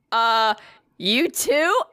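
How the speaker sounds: high, hesitant questioning reply; baffled reply. Retold or imitated. Customer answering the employee's odd greeting, baffled. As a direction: imitate an unsure answer, lifting at the end. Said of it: "And I just, I was like".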